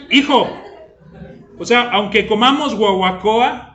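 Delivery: a father half-joking, half-exasperated. Acted. Father loudly reassuring his fretful son he won't starve. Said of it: "father answers son, exasperated".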